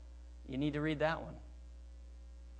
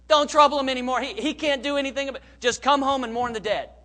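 raised, high-pitched urging to give up; higher and louder, pressing him to just leave; loud dismissal; 9 semitones higher, 10 dB louder than usual. Acted. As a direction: act out the friends loudly waving off any hope. Acts out friends dismissing Jesus to Jairus.

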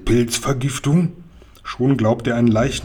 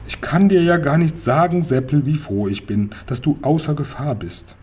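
usual speaking voice

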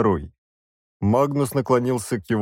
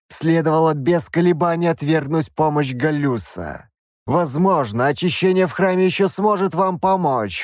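higher, preaching bishop voice; stern bishop; 5 semitones higher than usual. A narrator's reading. Bishop condemns the princess for accepting help, sternly. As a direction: lecture them like a zealous cleric. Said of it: "bishop lectures the royals".

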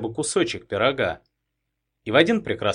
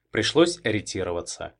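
ordinary delivery, nothing marked

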